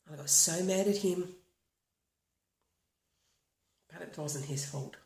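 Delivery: walk them through tapping calmly, line by line; guided tapping script. Acted. Leads a tapping routine.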